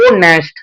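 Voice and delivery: ऑनेस्ट with a drawn opening vowel, no h; vowel-first pronunciation. Shown as a demonstration. Teacher answering what is heard first, vowel clearly sounded. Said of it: "pronunciation में क्या आ रहा है?".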